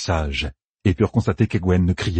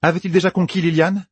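higher-pitched character voice, pressing rhetorical questions; challenging debater; 9 semitones higher than usual. Acted. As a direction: press each question like an argument. Personnage enchaîne des questions rhétoriques. (ton de contestation insistant)

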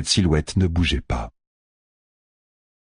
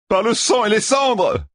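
crying out. Acted. Young gambler Matt cries out on the flying creature, very high. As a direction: yell it clinging on in the wind. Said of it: "s'écria le jeune flambeur".

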